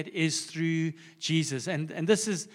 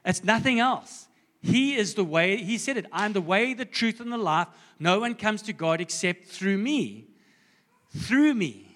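forceful preaching; 4 semitones higher than usual. Acted. Climactic declaration of scripture.

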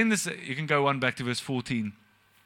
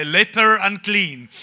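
slow, louder mock cry of leper unclean; mock leper's call; 3 semitones higher, 8 dB louder, much slower than usual. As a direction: call it out slowly like a leper's bell warning. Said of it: "had to have a bell that say".

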